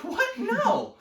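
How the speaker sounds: very high-pitched, incredulous outburst; mock disbelief. Rejecting the friend's wrong guess, high mock disbelief. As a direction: squawk it in comic exasperation.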